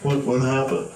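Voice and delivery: high, slow baffled little voice; baby voice. Acted. Baby asking what happened, high and slow. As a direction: play a lost little baby. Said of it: "the little baby's just like".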